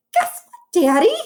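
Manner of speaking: put-on high, eager little-girl voice; bursting to tell her dad; excited child. A narrator's reading. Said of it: "she said".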